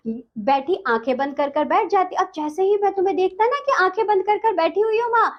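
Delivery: ordinary talk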